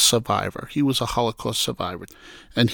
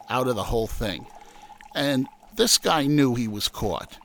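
ordinary delivery

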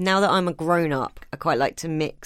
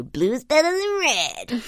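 Sonic voice; 8 semitones higher, slower than usual. Retold or imitated. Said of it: "turn to people and go".

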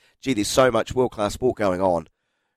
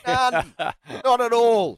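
mock denial; 11 semitones higher, much slower than usual. Mock denial, laughing high.

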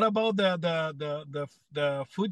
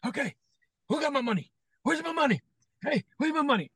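high-pitched mock demand for money; retold demand; 9 semitones higher, faster than usual. [name] to the crowd after losing his money, retold demand.

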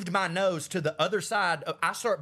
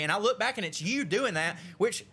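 ordinary talk, nothing marked

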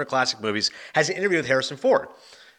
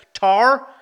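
excited; 10 semitones higher, 6 dB louder than usual. Real, not acted. Excited announcement of surprise nominee.

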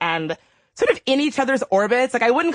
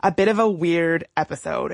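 ordinary talk

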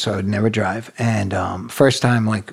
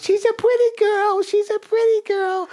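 put-on very high, childlike singsong. Police are yelling at the ex, feigned childlike squeal. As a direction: coo it in a squeaky simpleton voice, put on.

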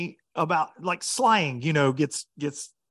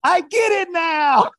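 put-on very high, loud, slow accented shout; slow shouted Japanese accent; 13 semitones higher, 8 dB louder, slower than usual. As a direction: belt out the punchline as the foreigner. Japanese angler voiced, punchline.

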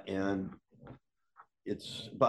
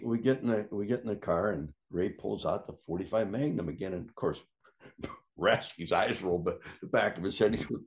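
ordinary talk

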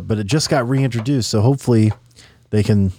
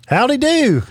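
folksy howdy; 12 semitones higher than usual. Pretend. Playful folksy greeting between hosts.

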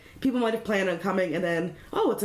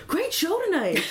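high, bright imitation of a fan; high mock fan voice; 9 semitones higher than usual. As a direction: quote him up high. Stranger quoted in story.

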